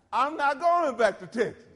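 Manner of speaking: emphatic no-way declaration; emphatic refusal. Retold or imitated. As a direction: declare it like you mean it forever. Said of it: "I said it a little more emphatically than that".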